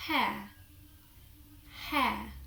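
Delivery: "hair" with the R left silent; open-ended non-rhotic "hair"; British "hair". Shown as a demonstration. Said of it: "I would say hair".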